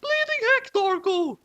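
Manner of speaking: high falsetto scold; swearing mom. A narrator's reading. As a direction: snap it in a cartoon mom voice. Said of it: "she swore".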